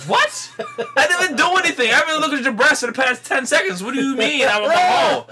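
high, loud, laughing disbelief; incredulous protest. Retold or imitated. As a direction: squeal it, like you're wrongly accused. Acting out his retort to women.

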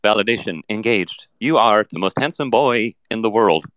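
synthetic robot voice; chatbot. Acted. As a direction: speak like a polite, literal machine. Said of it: "run program validation".

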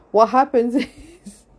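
real helpless laugh mid-sentence; can't stop laughing. Real, not acted. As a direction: try to get the sentence out through laughter. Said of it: "I can't stop laughing".

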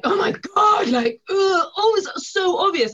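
mock-despairing loud cry at slow colleagues; exasperated cry. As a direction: howl it like nobody keeps up. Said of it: "And you're like".

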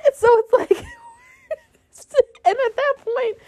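high, cracking laugh with a shout; half laughing, half crying; exasperated laughing cry. Real, not acted.

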